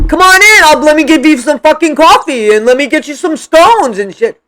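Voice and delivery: loud, sneering fake hospitality; mock her fake politeness, loudly; sarcastic mock welcome. Mocking Christy's fake hospitality.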